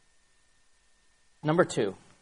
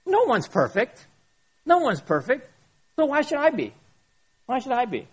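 whiny excuse; 7 semitones higher than usual. Retold or imitated. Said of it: "The next one is".

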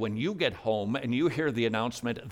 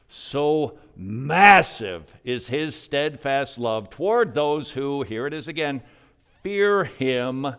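loud, slow, swelling reading; emphatic scripture reading; 3 semitones higher, 7 dB louder, slower than usual. Real, not acted. Preacher reading the psalm aloud to church, loud and slow. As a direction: proclaim the verse with full force.

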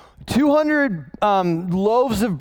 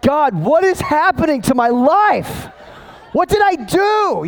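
mock despair; 8 semitones higher, 4 dB louder than usual. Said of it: "I'm like".

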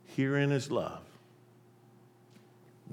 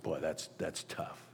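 admiring murmur; 8 semitones lower, 13 dB quieter than usual. Retold or imitated. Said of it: "It's like".

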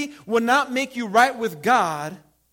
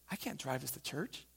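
laughing aside; 7 semitones lower, 15 dB quieter than usual. Retold or imitated. Chaplain's reaction to new truck.

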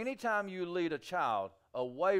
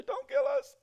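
pleading demons; 10 semitones higher, 4 dB louder than usual. Retold or imitated. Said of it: "fall at his feet, and say".

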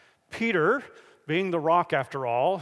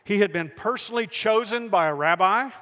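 ordinary talk

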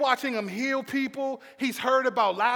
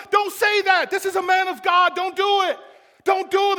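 high, loud protest; full-voiced, raised pleading shout; desperate yelling; 8 semitones higher, 7 dB louder than usual. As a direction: yell at the crowd to stop. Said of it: "And he's yelling".

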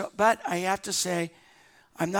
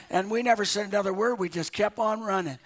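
ordinary talk, nothing marked

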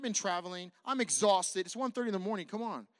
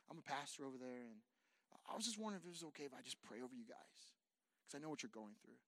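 much softer, careful and hushed; hushed and gentle; 4 semitones lower, 10 dB quieter than usual. Retold or imitated. Pastor approaching a grieving family member, hushed gentle offer. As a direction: speak softly so as not to intrude.

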